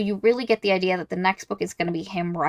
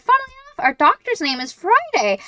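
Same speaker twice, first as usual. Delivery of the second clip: much higher, sing-song nurse announcing the doctor; a bubbly nurse cheerfully naming the doctor, high and bright; sweet nurse voice; 13 semitones higher, faster than usual. Retold or imitated. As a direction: play the oblivious cheery nurse.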